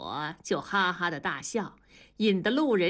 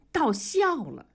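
ordinary delivery

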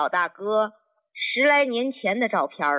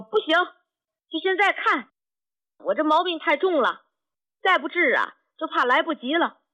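retold urgent holler; 9 semitones higher than usual. Old patient demands the doctor see him now, retold urgent cry. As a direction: imitate an anxious patient insisting, voice pitched up high. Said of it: "又来了一嗓子".

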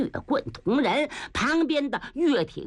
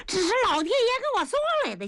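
gleeful; 11 semitones higher, faster than usual. Acted. Niu Gao delighted at catch.

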